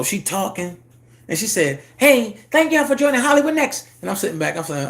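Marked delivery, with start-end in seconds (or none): 2.0-3.9 higher, louder, bright show-host greeting; mock livestream host welcome; host's opener voice. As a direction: greet the audience like a live host.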